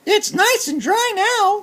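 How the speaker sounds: very high, squeaky little chipmunk voice; tiny chipmunk voice. Acted. Chipmunk greeting Bear at the party, very high squeaky voice. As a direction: pitch way up like a small sweet chipmunk.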